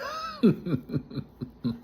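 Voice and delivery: high, trailing chuckle; amused laugh. Real, not acted. Vlogger tickled by the old Brooklyn, amused laugh. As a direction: let out a long, high laugh.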